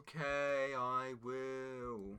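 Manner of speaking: soft, low, drawn-out reply; exaggeratedly slowed down. Sidekick told to slow down, replying drawn out. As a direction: stretch each word out obediently. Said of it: "can you just slow down".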